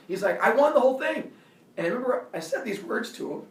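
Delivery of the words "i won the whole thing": high, loud boast; imitated insistent yell. Bully to the kid he fought, high loud insistence. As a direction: crow it like a self-declared winner. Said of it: "he keeps yelling".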